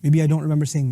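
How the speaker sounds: ordinary talk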